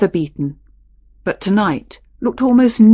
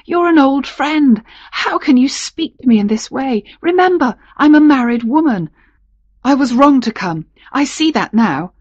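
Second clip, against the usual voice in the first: high-pitched, flustered protest; half afraid, half playful; 6 semitones higher than usual. A narrator's reading. Married woman reproaching an old friend, half afraid. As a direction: remonstrate with him in a raised, lilting voice. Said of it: "half afraid, half playful".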